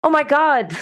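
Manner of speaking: dazed high voice, waking up confused; like someone snapping out of a trance, lost; bewildered. Retold or imitated. Listener suddenly adrift after a podcast, bewildered and high. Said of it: "suddenly you're like".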